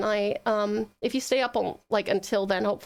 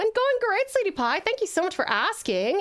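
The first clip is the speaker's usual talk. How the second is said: gushing; 7 semitones higher, 5 dB louder than usual. Real, not acted. Gushing reply to viewer.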